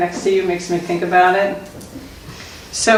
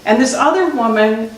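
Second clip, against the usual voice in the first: ordinary talk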